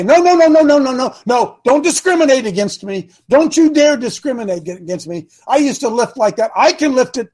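loud, high indignant "don't discriminate against me"; mock indignant protest. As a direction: play the offended old man, loud and high. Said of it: "should I say".

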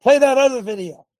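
ordinary talk

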